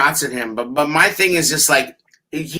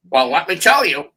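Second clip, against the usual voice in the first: Norman Bates voice. Retold or imitated. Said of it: "Sounded like Norman Bates".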